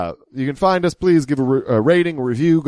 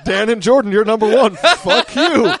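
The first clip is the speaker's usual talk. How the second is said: laughing mock rant; 11 semitones higher than usual. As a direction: spit out the insults while cracking up. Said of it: "people who he's like".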